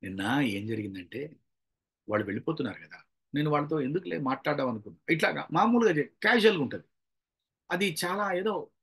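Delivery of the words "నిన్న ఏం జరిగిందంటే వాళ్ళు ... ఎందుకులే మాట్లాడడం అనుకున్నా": low, soft confiding murmur; lowest pitch. Shown as a demonstration. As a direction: drop right down low, as if to someone close. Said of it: "మంద్రస్థాయిలో ఉన్నామా". (మంద్రస్థాయిలో మెల్లగా)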